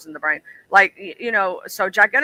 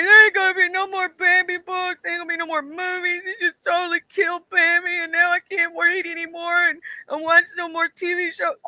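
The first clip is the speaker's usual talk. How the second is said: put-on crying kid; 11 semitones higher than usual. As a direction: imitate your child self sobbing over Bambi. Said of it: "I was like".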